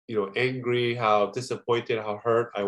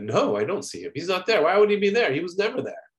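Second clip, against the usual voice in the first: bitter denial; 7 semitones higher than usual. Retold or imitated.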